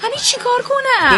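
high, exasperated wail; worked up and at a loss; fretting. Acted. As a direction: fret helplessly, pitch climbing. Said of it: "ولش کن، حرص نخور".

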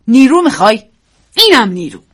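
loud sharp retort; angry. Acted. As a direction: slap back in anger. After slapping her. (پاسخ خشمگین و بلند)

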